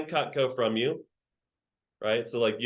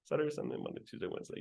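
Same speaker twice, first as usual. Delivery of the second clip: fading to a whisper; 12 dB quieter, much faster than usual. Acted. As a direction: rattle off the days, dropping to a whisper. Trails off listing days.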